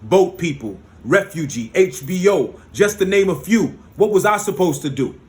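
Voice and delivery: ordinary talk, nothing marked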